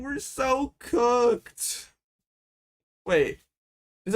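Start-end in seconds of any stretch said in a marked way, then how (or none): 0.0-1.9 despairing whine. Real, not acted. Despair after bad turn.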